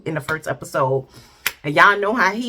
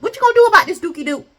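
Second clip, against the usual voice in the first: much higher, louder teasing demand; wife's sassy challenge; 11 semitones higher, 6 dB louder, much faster than usual. Acted. Wife to husband Duke about home, high sassy challenge. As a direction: throw the question at him with sassy exasperation. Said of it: "That's basically what she put out there".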